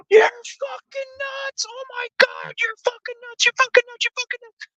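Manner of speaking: very high, panicked mock shrieking; terrified shriek. Imitating the hooker panicking at his reckless driving. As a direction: squeal in panic as the car swerves. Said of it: "I'm scaring the shit out of her".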